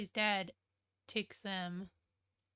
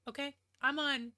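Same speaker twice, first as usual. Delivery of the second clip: ordinary delivery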